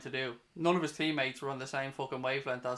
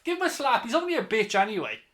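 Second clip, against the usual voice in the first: angry shout; 5 semitones higher, 4 dB louder than usual. Real, not acted. Fan yelling at referee.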